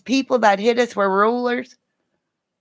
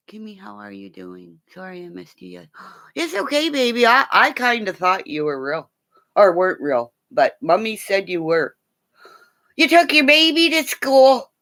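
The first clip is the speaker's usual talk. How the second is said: ordinary talk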